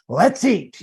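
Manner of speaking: high, playful menace; a hint of creepy fun Dracula; creepy yet funny vampire. Shown as a demonstration. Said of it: "funny and scary".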